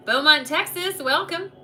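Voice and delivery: high, loud Texas twang. Real, not acted. Reader welcomes client from Beaumont, in a Texas twang. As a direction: let a Texas twang slip out. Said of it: "You hear that twang come out".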